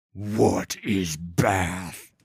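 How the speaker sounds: very slow, low troll rumble; rumbling gravelly voice. A narrator's reading. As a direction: rumble it like a dim giant. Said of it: "Yedu asks".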